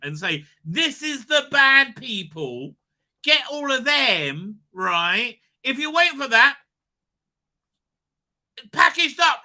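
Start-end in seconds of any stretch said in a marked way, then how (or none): 0.6-4.6 high, loud, slow mock command; mock villain order. Streamer mocking schemers with a loud slow order.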